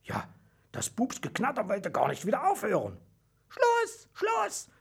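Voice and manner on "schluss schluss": very high squeaky cry to stop; tiny mouse voice. A narrator's reading. Mouse referee halts the hedgehog's turn, squeaking firmly. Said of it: "kam es aus Mausels kleinem Schnäuzchen".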